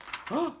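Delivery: very high, startled gasp. Acted. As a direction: gasp in fright. Mouse frightened.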